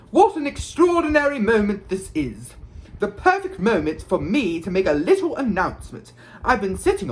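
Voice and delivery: grand, high showman's speech; loud announcement. A narrator's reading. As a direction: proclaim it to the whole crowd with self-satisfied pomp. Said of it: "he said loudly".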